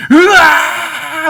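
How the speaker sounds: long, high, loud charging yell; acted war cry, drawn out; battle cry. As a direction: roar into the charge. Charging battle cry before hymn.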